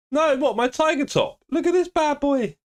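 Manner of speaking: very high squeaky exclamation; high voice at a normal clip; high-pitched showing off. Real, not acted. Showing off his tiger top.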